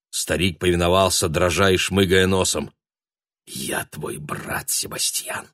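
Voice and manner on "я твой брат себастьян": шипящий шёпот сквозь зубы; шипит сквозь сжатые зубы; hissing through teeth. A narrator's reading. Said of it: "прошипел Шенди сквозь зубы". (зловещее шипение сквозь зубы)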